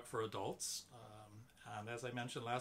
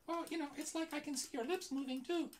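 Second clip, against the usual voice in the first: high squeaky puppet voice; squeaky ventriloquist dummy; 14 semitones higher than usual. Acted. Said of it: "this really weird high-pitched voice".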